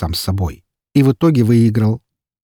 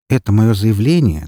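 ordinary delivery, nothing marked

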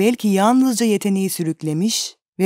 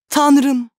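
cry out; 6 semitones higher, 4 dB louder than usual. A narrator's reading. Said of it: "diye bağırdı genç adam".

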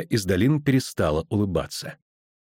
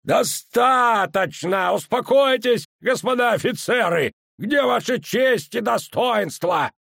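commanding officer; 12 semitones higher, slower than usual. A narrator's reading. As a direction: bark orders down at the officers. Danza commanding from gallery. (властный командир)

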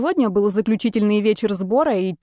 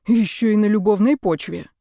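ordinary delivery, nothing marked

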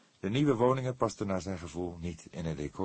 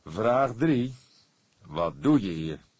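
louder, higher questioning; firm interrogation; 4 semitones higher, 7 dB louder, slower than usual. A narrator's reading. The cook questions Erik, loud and firm. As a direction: press him firmly.

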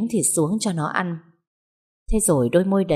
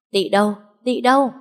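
raised, higher calling voice; 5 semitones higher, 4 dB louder than usual. A narrator's reading. Mistress calling for the maid Tị, urgent call. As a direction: call urgently for someone out of sight.

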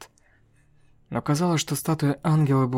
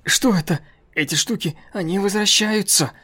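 panicked; 7 semitones higher, 5 dB louder than usual. Acted. Hunter panicking as statues return.

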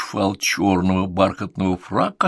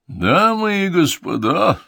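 higher, slow, louder climactic announcement; ringmaster at full pitch; 10 semitones higher, 4 dB louder, slower than usual. Acted. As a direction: whip the crowd into a frenzy. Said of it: "Голос конферансье достиг наивысшего накала".

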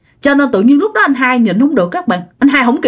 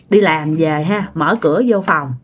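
normal talking voice

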